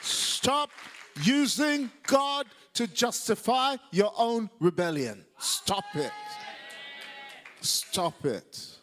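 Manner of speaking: high, booming shout with drawn-out words; forceful rebuke. Real, not acted. Preacher rebuking those who use God to justify rebellion, real shouted rebuke. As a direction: thunder it out as a command.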